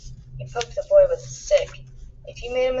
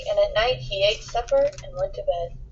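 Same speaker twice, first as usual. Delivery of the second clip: ordinary delivery, nothing marked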